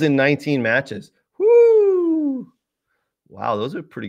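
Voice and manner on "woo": long, high-pitched whoop; whistle-like, drawn-out woo; impressed woo. Real, not acted. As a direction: let out a high, sustained "woo" at the stats. Marvels at striker's goal stats.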